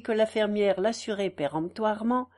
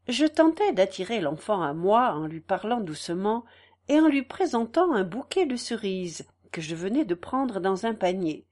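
plain reading voice

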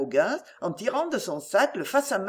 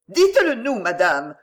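insistent plea; 2 semitones higher, 6 dB louder than usual. Acted. Wife joining her husband's plea, urgent and insistent. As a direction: chime in insistently. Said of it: "surenchérit madame Marondier".

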